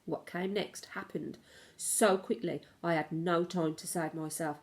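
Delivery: usual speaking voice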